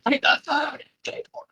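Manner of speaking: booming high bellow; hammed-up shouty actor; Brian Blessed impression. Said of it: "I downloaded Brian Blessed".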